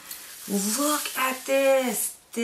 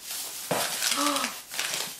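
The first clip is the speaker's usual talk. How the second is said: delighted gasp; 5 semitones higher, 6 dB quieter than usual. Real, not acted. Finding shark gummies.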